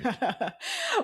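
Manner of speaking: bright burst of laughter at the question; startled high laugh. Real, not acted. Guest laughs at the host's magic wand question, high burst of laughter.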